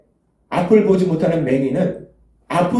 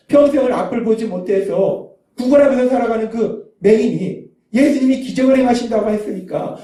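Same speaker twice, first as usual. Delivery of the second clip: ordinary delivery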